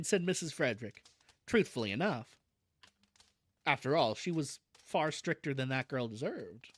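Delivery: ordinary delivery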